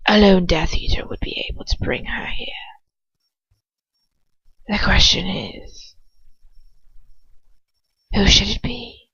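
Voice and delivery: cold hissing whisper of a dark lord; Voldemort whisper. Acted. Voldemort deciding who to send, in a cold whisper.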